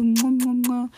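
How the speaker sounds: loud smacked air kisses; kissing sounds. Real, not acted. Podcaster signing off to listeners, kissing sounds. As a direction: blow big audible kisses.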